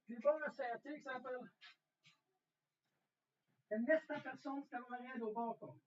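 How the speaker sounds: ordinary delivery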